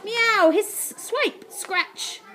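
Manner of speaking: high meow, hiss and claw effects; acted cat attack, bursting then fading. A narrator's reading. Army of cats swipes at Ned, hissing. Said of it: "hissed and swiped".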